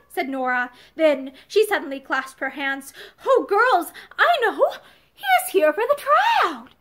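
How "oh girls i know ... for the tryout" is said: sudden bright realisation, much higher; bursting with the idea; excited. A narrator's reading. Nora telling her friends she has guessed, excited and much higher.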